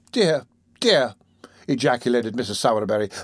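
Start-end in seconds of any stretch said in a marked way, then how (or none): 0.1-1.1 pious sighing woman. Acted. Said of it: "piously raising her eyes".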